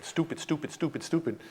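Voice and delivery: soft, repeated self-scolding under the breath; muttered self-reproach. Retold or imitated. Man curses himself as guest leaves, muttering. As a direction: scold yourself quietly. Thinking to himself, knees shaking.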